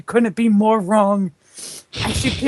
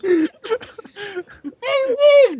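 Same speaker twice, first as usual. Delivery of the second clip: muffled; 13 semitones higher, 5 dB louder than usual. A narrator's reading. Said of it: "Jakai muffled".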